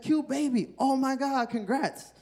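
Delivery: gushing fans. Retold or imitated. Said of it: "Everybody's like".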